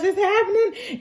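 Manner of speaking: put-on child on the verge of tears; tearful. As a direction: voice breaking on happening. Boy starting to cry.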